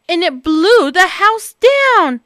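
dramatic. A narrator's reading. Climax of huff and puff.

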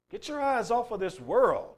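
usual speaking voice

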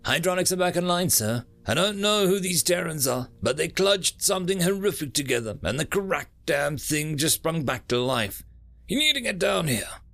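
excited chief engineer. Acted. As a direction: rush the good news.

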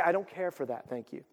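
soft, restrained polite refusal. Shown as a demonstration. Guest at the lunch table, softly polite refusal. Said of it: "You had to say".

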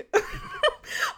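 real laugh shooting up very high; high burst of laughter. Real, not acted. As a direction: laugh at the memory of it. Laughing at her own story.

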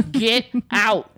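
laughing command. Real, not acted. Preaching to listeners, laughing.

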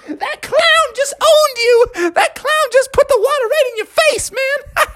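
put-on very high squeaky jeering kid; mocking kid voice. Kids laugh at the sprayed kid, mocking high voices. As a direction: crow high at a friend's bad luck.

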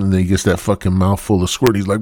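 normal talking voice

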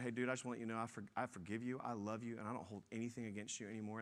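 quiet, fast, gentle confession; quiet rushed retold apology to a friend; soft forgiveness. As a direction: say it softly and quickly, like an offering. Forgiving friend over lunch.

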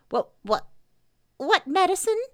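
falter, then squeak the question; alarmed Piglet. A narrator's reading. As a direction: stammer rising to a high squeak.

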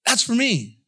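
thrilled it's meant for you; excited discovery. Acted. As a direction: burst out like you just found it. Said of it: "You're like".

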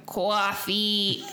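drawn-out Northern "Coffee"; imitating a resident's Northern accent; imitated Northern accent. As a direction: call for it like an old lady from up north. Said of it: "she says it like that".

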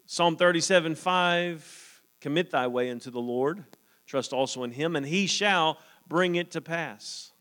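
measured, lifted reading of the verses; scripture reading. Acted. Preacher reading psalms to the church, measured and lifted. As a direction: read the psalm aloud to the congregation.

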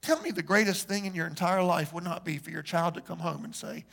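ordinary talk

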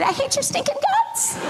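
very high, laughing mock venom; inner thought. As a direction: spit the secret thought out while laughing. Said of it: "And on the inside".

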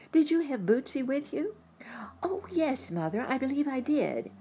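put-on high child's eager reply; high-pitched young girl answering; little girl voice.

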